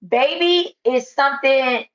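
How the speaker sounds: loud, slow preaching to a crowd. Acted. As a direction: preach it out at full force. Preaching loudly to an audience.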